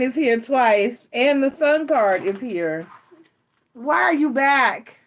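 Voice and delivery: ordinary talk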